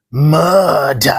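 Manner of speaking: Murder with rolling R and long U, stabbing the da; Scottish barman. Retold or imitated. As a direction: imitate the barman, roll the R in Murder. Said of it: "That's how he says it, with two U's, a rolling R".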